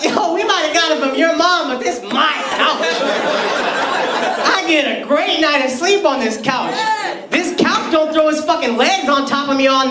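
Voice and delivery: high-pitched, hammed-up indignant rant; defiant couch love. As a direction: proclaim devotion with comic outrage. Acted defiant rant praising the couch.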